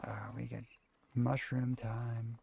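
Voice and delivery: whispering. Acted. Sneaky aside about mushroom.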